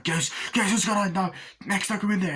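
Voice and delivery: high, urgent acted alarm; frantic. Max rushing to the hospital for Goose, frantic. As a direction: raise the pitch in mock panic.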